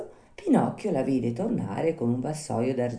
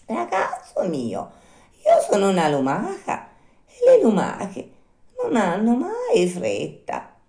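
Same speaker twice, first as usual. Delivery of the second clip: unhurried snail; 7 semitones higher, 4 dB louder than usual. Acted. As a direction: draw it out with total phlegm. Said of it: "tutta pace e tutta flemma".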